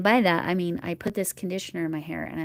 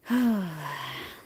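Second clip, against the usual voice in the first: long, audible exhale; weary sigh. Real, not acted. Crocheter finds another yarn tangle, weary sigh.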